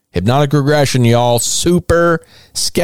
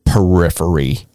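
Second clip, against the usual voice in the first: mocking fancy word; 7 semitones lower than usual. Shown as a demonstration. Host mocking the witness's big word, low sneer. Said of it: "did not say luminosity and periphery".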